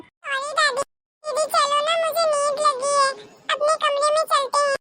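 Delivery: put-on high little boy covering up to grandma; child voice. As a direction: play a small child making an excuse. Sleepy child talks to sister. (दादी से बहाना बनाता बच्चा)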